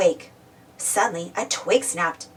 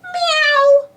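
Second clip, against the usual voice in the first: very high, loud meow; cat screech; 6 dB louder than usual. A narrator's reading. Pilchard surprised by Spud, screeching meow. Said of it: "screeched Pilchard".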